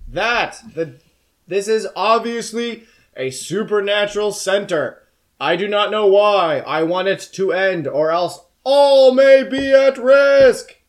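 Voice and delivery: high, slow booming demon cat; slow booming creature voice. Acted. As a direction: boom it out like an otherworldly cat. Demon cat speaking to court.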